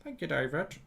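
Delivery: soft, put-on American thanks; American accent. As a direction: repeat it quietly in mock American. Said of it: "Your American accent sounds a bit like".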